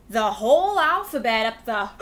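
usual speaking voice

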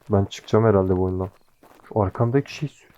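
normal talking voice

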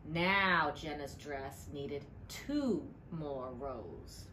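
ordinary delivery, nothing marked

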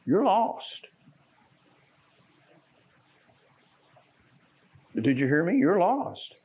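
high, pressing warning; urgent warning. Real, not acted. Preacher warning the unsaved church in a high urgent voice. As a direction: hammer home that they are lost.

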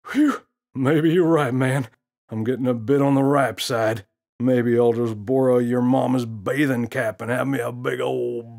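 put-on a cocky gorilla teasing the kid; smirking gorilla. Gorilla voiced in story.

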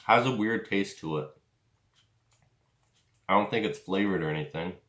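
biting jerky strip; talking while chewing. Real, not acted. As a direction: mouth partly full.

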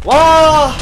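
크게 길게 뽑는 "와"; 기막혀 터지는 감탄사; 어이없는 탄성. Real, not acted. 결과에 어이없어함. (큰 소리의 긴 탄성)

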